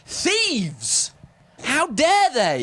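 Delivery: high-pitched, drawn-out indignation; mock outrage. Briton hears of an Oxford in Ohio, mock indignant cry. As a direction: cry it out, theatrically affronted.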